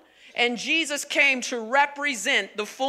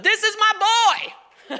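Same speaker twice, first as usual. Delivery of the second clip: high, drawn-out proud proclamation; playful, hammed-up proud father; proud announcement; 7 semitones higher, slower than usual. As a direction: announce your boy to everyone, slowly and high. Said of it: "God announces his son".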